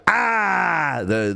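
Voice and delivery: normal talking voice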